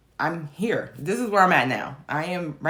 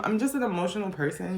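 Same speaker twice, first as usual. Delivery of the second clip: ordinary talk, nothing marked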